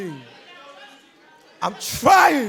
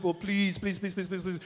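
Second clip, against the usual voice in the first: repeated please sinking almost to nothing; fading pleas; 11 dB quieter than usual. Retold or imitated. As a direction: let each "please" fade softer until barely heard. Begging, fading to near silence.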